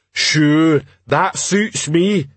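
slow, high troll voice; agreeable troll. Acted. Troll agreeing with the heckler, slow reply. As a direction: agree ponderously.

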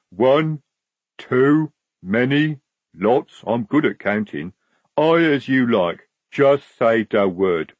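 troll counting, each word set apart; obliging troll. Acted. Troll private boasting of counting, simple and willing.

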